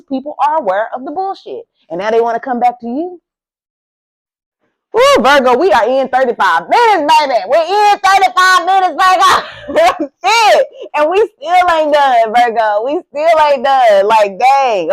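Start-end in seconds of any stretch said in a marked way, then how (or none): 4.9-10.2 loud, high and excited, half laughing. Real, not acted. Excited the reading runs long. 10.2-10.7 laughing. Real, not acted. 10.9-14.9 loud, high and excited, half laughing. Real, not acted. Excited the reading runs long.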